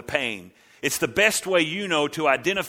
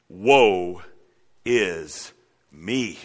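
low drawn-out lament; mournful woe; 8 semitones lower, much slower than usual. Acted. Isaiah lamenting before the Lord, low and mournful. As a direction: groan the woe out slowly.

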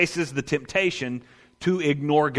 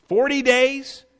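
higher, louder mock disbelief; incredulous; 7 semitones higher, 4 dB louder, slower than usual. Satan taunting hungry Jesus, hammed-up disbelief. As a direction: throw the question up in feigned shock.

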